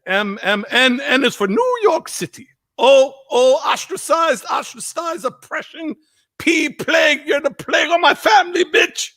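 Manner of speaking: high, shouted mock Jamaican rant; Jamaican dad, slipping Irish. Drunk Jamaican dad cursing his son, imitated high shout. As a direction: shout it in a put-on Jamaican accent drifting Irish. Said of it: "it did get a little Irish there".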